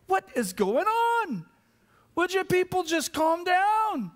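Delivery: high-pitched exasperated plea; exasperated outburst. Acted. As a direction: act out fed-up disbelief at the racket. Voices exasperated reaction to ruckus.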